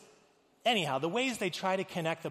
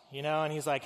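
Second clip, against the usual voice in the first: usual speaking voice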